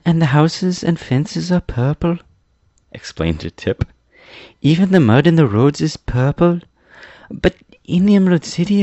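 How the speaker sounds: put-on high-pitched boyish storyteller; eager boy Tip explaining. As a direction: explain it all in a high boy's voice.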